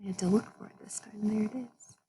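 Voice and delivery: fast hushed whisper aside; breathless whispered aside to the listener. Real, not acted. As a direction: lean in and whisper it quickly, like a secret.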